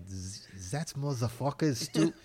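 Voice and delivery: put-on a broken-off, half-hearted German accent as Red Skull; mock German accent. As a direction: try a German villain accent and give up on it. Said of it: "I can't do a German accent".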